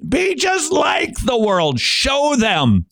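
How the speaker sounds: a high, shouted mock pep slogan. Mocking the American church's motto, shouted high and cheerful.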